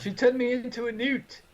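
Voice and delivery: high-pitched mock outcry; accusing film peasant. Quoting a favorite movie bit, high indignant peasant.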